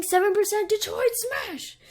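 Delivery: high, strained move call; attack yell. Acted. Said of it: "he's like".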